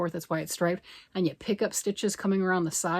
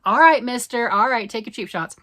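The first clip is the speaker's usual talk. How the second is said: mock retort; 5 semitones higher, 10 dB louder than usual. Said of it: "He's like".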